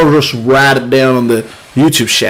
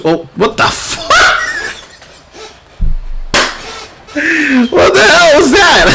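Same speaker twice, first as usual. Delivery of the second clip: laughing disbelief. Real, not acted. Streamer sees something absurd on screen, laughing hard. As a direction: crack up mid-question at the screen.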